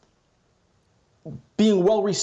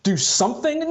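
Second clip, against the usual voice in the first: incredulous; 8 semitones higher than usual. Real, not acted. Incredulous echo of the question.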